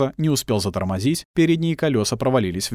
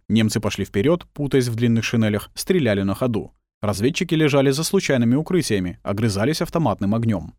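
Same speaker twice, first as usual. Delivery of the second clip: ordinary delivery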